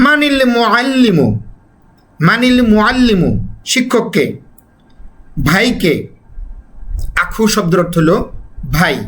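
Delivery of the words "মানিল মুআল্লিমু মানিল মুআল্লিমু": মানিল মুআল্লিমু slowly, মানিল joined to মুআল্লিমু; slow demonstration. Shown as a demonstration. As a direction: model the linked মানিল slowly. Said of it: "মানিল উচ্চারণ করব".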